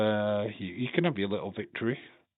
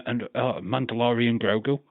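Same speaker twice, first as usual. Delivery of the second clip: ordinary talk, nothing marked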